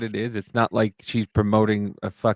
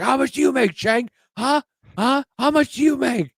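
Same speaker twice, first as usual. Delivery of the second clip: high-pitched, loud mock yell; imitated yelling; 6 dB louder than usual. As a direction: badger him like an angry debater, shouting. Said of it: "he starts yelling at Charlie".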